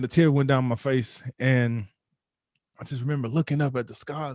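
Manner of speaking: usual speaking voice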